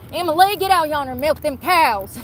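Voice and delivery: high, loud hillbilly call to the barn; mock Southern holler. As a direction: holler it like a Southern farm mama. Mock Southern farm parent calling.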